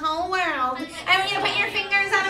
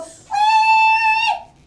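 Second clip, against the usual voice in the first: high loud shouted wee; teapot squeal; 9 dB louder than usual. Shown as a demonstration. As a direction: shriek it like a whistling teapot. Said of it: "you're a teapot and there's steam coming out".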